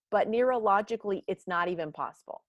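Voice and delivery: normal talking voice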